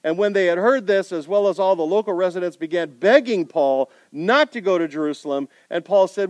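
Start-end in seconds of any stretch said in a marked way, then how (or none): none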